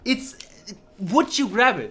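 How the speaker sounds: high, loud exasperated demand; shouting. Real, not acted. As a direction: shout it at the game character, pitched high. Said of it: "Stop shouting. You're scary".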